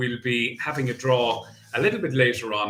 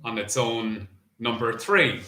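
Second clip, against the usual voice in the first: bingo caller; 3 semitones higher than usual. Acted. Bingo number calling.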